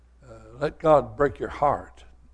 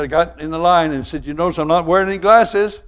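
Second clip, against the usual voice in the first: higher and louder, bragging; proud old man; 6 semitones higher, 7 dB louder than usual. Retold or imitated. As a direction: crow about the cure like a proud old man. Proud old man retells healing.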